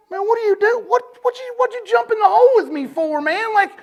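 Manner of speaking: high, loud, frantic protest; incredulous high-pitched outburst, loud throughout; exasperated yelling. Retold or imitated. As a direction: act out yelling at someone who just made things worse. Acted panicked protest at man jumping in.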